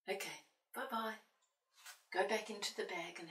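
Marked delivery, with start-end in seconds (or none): none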